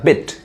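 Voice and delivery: "बिट" said quickly with a short i; teacher's short-sound demo of "बिट"; short, fast vowel. Shown as a demonstration. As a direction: keep the i in "बिट" brief. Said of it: "fast पढ़ाता है".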